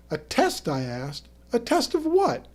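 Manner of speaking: baffled question. Acted. Baffled by Terry's demand.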